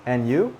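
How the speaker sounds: "And you?" rising sharply on "you"; questioning intonation. Shown as a demonstration. Teacher showing learners the intonation, "And you?" rising on "you". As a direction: lift "you" at the end of "And you?". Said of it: "the intonation is important".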